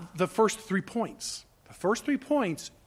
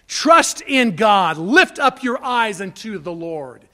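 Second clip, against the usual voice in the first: loud and slow, each word weighed; preacher urging the church to look up; loud slow exhortation; 9 dB louder, slower than usual. Acted. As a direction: plead with the flock to trust. Emphatic exhortation.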